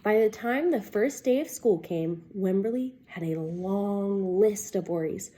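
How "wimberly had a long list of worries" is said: ordinary delivery, nothing marked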